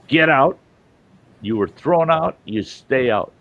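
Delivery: a bouncer putting his foot down; bouncer's order. Retold or imitated. Bouncer ordering the little guy out of the bar, loud and stern.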